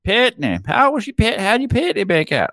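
high, sing-song Australian imitation of partner as Patney; Australian accent. Teasing friends about the word partner in an Australian accent. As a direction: mimic an Aussie saying Patney. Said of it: "can't do the Australian accent right now".

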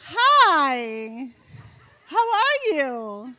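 high, loud, slow greeting; forced sweetness. Retold or imitated. Mother greeting daughter's friend at the door, high slow sweet greeting. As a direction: greet the guest overly politely. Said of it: "And I was like".

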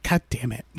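low, soft, weary curse; imitated exasperation. Imitating a girl who knows it's a creepy trope, exasperated. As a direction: imitate a child fed up with it all, under the voice. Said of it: "she's exasperated by it".

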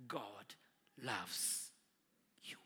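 quietly loving reassurance; soft tender murmur. Retold or imitated. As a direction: breathe it to him gently. Old man's gentle phone message.

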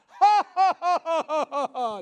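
big staccato ha-ha laugh, high then falling; mocking laughter. Shown as a demonstration. Believer laughing at the devil's lies, loud mocking laughter. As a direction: laugh loudly in the devil's face. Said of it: "You should start laughing".

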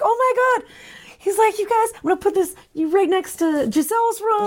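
high-pitched, giddy imitation of a doting father; hammed-up excited host; mock gushing. Voices gushing welcoming father.

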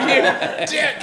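laughing insult. Retold or imitated.

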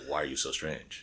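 low, hushed, puzzled aside; quietly voiced unspoken question; imagined judging thought. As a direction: murmur it low, like a private thought. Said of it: "you're just like".

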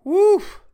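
high, loud gag-like woof; retching woof. Real, not acted. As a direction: blow out a nauseous woof. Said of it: "almost just threw up".

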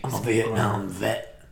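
slow-talking veteran, played for laughs; mock Vietnam vet. Co-host echoing the vet introducing himself, slow mock veteran voice.

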